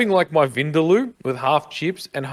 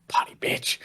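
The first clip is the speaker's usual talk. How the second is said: whispered insult under the breath; whispering; 7 dB quieter than usual. Retold or imitated. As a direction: whisper it so she barely hears. Muttered insult at assistant.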